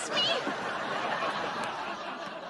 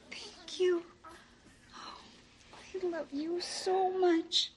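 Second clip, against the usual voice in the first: teary; 12 semitones higher, much slower than usual. Real, not acted. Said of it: "Too late".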